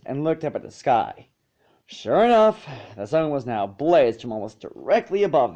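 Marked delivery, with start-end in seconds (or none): none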